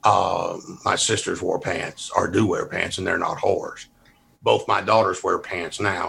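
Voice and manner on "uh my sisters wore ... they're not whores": ordinary delivery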